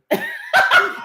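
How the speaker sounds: high squealing laugh; high burst of laughter. Real, not acted. Laughing at her own joke, high squealing laughter.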